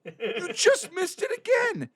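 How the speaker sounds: very high, drawn-out exclamation; exasperated disbelief. Real, not acted. Friend missed the joke again, exasperated cry. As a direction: pitch it way up, stretched out in disbelief.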